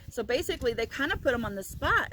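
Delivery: ordinary talk, nothing marked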